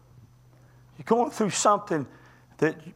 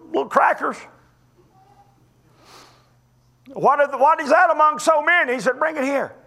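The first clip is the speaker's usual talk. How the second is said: high, loud, driving sermon delivery; full-force preaching; 8 semitones higher, 6 dB louder than usual. Real, not acted. Preacher retelling Bible miracles to the congregation, full-force preaching. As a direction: preach it hard and high, pushing the stressed words.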